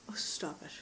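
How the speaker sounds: hushed whisper. Real, not acted. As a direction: breathe it out under the breath. Teasing over repeated word.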